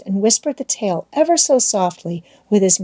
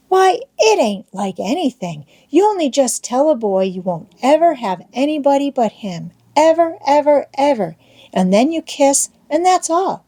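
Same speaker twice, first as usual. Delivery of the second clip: put-on higher, lively boyish explaining; eager schoolboy Tom; 7 semitones higher than usual. A narrator's reading. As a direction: explain it like a keen boy. Boy explains engagement.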